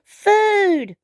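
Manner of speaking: high, eager shout for food; high-pitched happy exclamation; delighted cry. Acted. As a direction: cry out like a hungry little dinosaur. Hungry dinosaur spots food.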